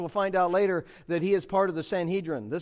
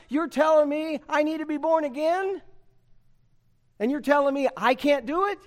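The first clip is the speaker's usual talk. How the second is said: disbelieving Nicodemus, rising louder; Nicodemus, incredulous; 7 semitones higher, 4 dB louder than usual. Acted. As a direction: protest like a baffled old teacher. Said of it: "Nicodemus says".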